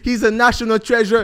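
loud, high build-up to a name; hype announcer shout. Real, not acted. Host hailing Jose as a treasure, shouted hype. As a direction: proclaim it like a ring announcer.

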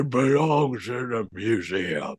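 slow, lightly voiced old Indy; a hint of an old Harrison Ford; old man voice. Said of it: "he still had old man voice. He was like".

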